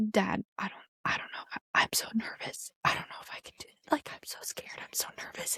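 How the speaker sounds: hushed, panicky whisper to her dad; nervous whisper. Retold or imitated. Bride whispering her nerves to her dad before the doors open, scared hush. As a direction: whisper it like you're terrified before walking out.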